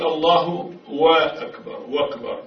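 الله أكبر as "Allahu wa-akbar"; slow, drawn "wa" before أكبر; wrong added waw. Shown as a demonstration. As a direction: put a w sound in front of أكبر. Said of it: "يقرؤونها بشكل خاطئ".

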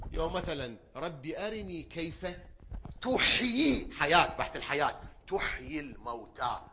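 loud, high, slow sermon cry; full-force preaching. Acted. Preacher reciting Abraham's plea, loud and high. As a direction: lift the verse like a call.

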